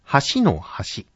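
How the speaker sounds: 橋の橋 read slowly as a model with clear pitch accent; accent demo. Shown as a demonstration. Teacher modelling 橋の橋 for announcer trainees, careful demo. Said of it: "まず一つ目は".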